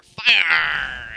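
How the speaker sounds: loud excited shout. Real, not acted. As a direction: yell it out with glee. Excited yell at new power.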